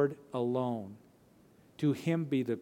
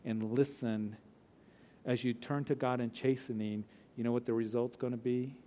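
normal talking voice

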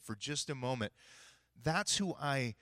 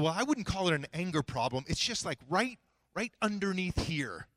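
acted-out intensity, louder and higher; heated self-justification; 6 semitones higher, 6 dB louder than usual. Client defending himself to coach about anger, heated and intense. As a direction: insist you're not angry while sounding worked up.

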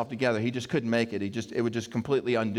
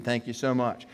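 usual speaking voice

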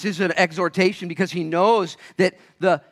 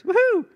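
mock cheer; 5 dB louder than usual. Mock cheer over gold mine.

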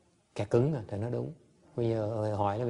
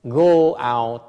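forceful command; 2 semitones higher, 11 dB louder than usual. Real, not acted. Urging disciples to save beings.

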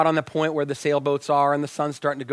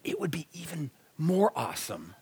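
hushed, conspiratorial whisper; softly whispered teasing aside; 9 dB quieter than usual. Retold or imitated. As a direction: lean in and whisper it like a secret. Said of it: "I said".

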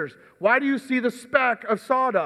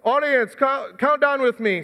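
usual speaking voice

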